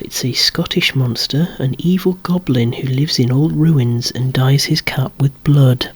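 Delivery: normal talking voice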